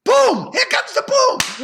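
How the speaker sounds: high, loud triumphant cry of the title; excited shout. Real, not acted. Remembering the movie title at last, a loud excited shout. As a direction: blurt it out in relief, loud and high.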